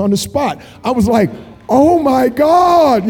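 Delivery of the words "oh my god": high, long drawn-out exclamation; amazed outburst. Retold or imitated. Preacher reliving seeing her back healed on the spot, amazed outburst. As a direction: stretch it out slowly in astonishment.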